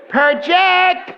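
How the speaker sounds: hammed-up teacher screaming project; mock teacher scream. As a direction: bellow it like a tiny drama teacher from the corner. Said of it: "That's all I ever heard her screaming".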